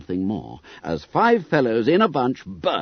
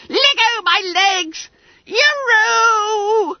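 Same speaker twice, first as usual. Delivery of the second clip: high slow desperate yell; frantic yell; 7 dB louder, much slower than usual. A narrator's reading. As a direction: yell to be let go. Bunter shaken.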